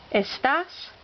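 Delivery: estás with TÁS stressed; stress on accented syllable. Shown as a demonstration. Teacher listing words with written accents, stress on accented syllable. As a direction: land on the final syllable of estás. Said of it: "words that need written accents".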